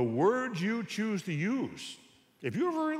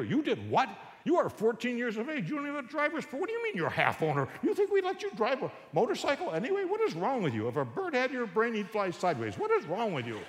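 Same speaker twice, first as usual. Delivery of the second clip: outraged dad rant; 8 semitones higher than usual. Retold or imitated. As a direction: tear into the son in disbelief. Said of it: "I'll tell you what you'd say".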